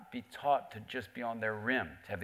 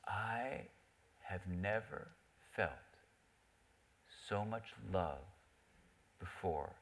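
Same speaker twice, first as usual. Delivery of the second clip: softer, hushed, slow words with long pauses; a man admitting something tender, hushed; quiet confession; 3 semitones lower, 8 dB quieter, slower than usual. Retold or imitated. Parliamentarian quietly confessing to the speaker, hushed and slow. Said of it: "he gets really quiet".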